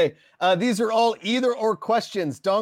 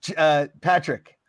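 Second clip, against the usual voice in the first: normal talking voice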